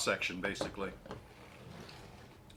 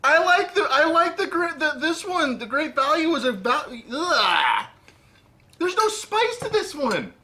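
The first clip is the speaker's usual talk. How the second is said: dramatic disgust; 7 dB louder than usual. Real, not acted. Tasting a disliked sardine brand.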